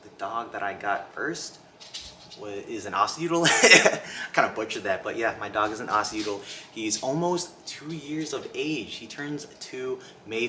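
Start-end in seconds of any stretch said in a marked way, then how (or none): 3.5-4.3 short embarrassed giggle; quick breathy laugh. Real, not acted. Laughs at butchered word.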